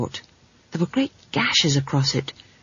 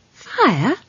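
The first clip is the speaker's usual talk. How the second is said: ordinary delivery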